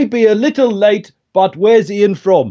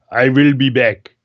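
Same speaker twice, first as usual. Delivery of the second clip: low, mock Germanic action-hero accent; deep Austrian-accented movie catchphrase; Arnie Schwarzenegger; 7 semitones lower than usual. Shown as a demonstration. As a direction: do a heavy Germanic accent, pitched down. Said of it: "more like Arnie Schwarzenegger".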